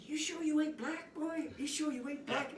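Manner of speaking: high, loud marveling voice; imitating the backup singers. Backup singer teasing the new singer, high and amazed. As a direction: squeal it out in amazement.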